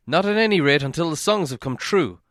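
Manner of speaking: uneasy men of the town; wary refusal. A narrator's reading. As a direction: firmly decline to stay. Townsmen refuse to stay.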